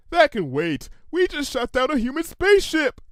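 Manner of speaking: grinning. A narrator's reading. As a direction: grin through the words. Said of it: "as she grinned massively".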